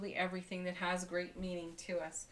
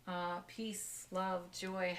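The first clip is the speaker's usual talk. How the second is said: ordinary talk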